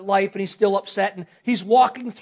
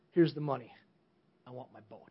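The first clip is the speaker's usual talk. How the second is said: softer and lower, a hushed earnest request; a boy quietly asks for his boat, softer and lower; quiet determination; 10 semitones lower, 11 dB quieter, faster than usual. Acted. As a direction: say it softly, holding out the money.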